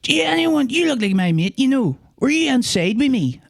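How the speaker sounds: high mock stranger voice; imitating stranger. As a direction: pitch up as the odd man asking. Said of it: "walked up to me and goes".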